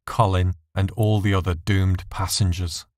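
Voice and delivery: plain reading voice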